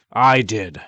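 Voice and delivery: loud roaring ogre; booming ogre answer. Acted. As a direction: roar it at the girl. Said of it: "roared Redcap".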